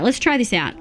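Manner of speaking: usual speaking voice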